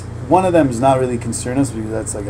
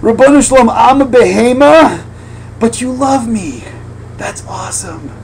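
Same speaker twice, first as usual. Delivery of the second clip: louder, higher, rising in joy to God; ecstatic prayer; 8 semitones higher, 6 dB louder than usual. Retold or imitated. In the minyan crying out to God, fervent and loud. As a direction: pour it out in awe before God. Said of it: "be like".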